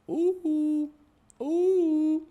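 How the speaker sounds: very high cooing; cooing at dog. Real, not acted. Owner cooing at his dog, very high. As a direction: coo up high at the pet.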